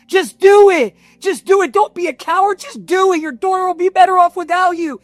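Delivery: high, urgent self-directed yell; reliving a desperate shout; yelling at myself. Retold or imitated. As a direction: goad yourself loudly, pitch way up. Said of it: "I remember yelling at myself".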